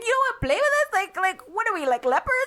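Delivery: high, hurt mock-child voice; offended kids. Aunt imitates kids feeling treated like outcasts, high indignation. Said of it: "It's like".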